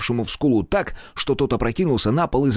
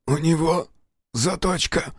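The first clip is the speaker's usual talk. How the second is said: a hoarse, higher repeat of the warning; suddenly hoarse; 8 semitones higher, slower than usual. A narrator's reading. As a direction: croak the warning out, voice gone. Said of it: "внезапно осипшим голосом".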